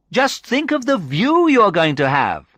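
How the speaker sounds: high, loud, thrilled encouragement; excited. A narrator's reading. Sandra encourages the nervous visitor about the view, excited. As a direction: sell the thrill in a high, ringing voice.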